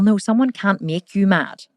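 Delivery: ordinary talk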